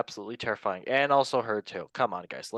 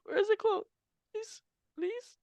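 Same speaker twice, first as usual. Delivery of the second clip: pleading squeak; 4 dB quieter than usual. Real, not acted. Pleading to find the quote.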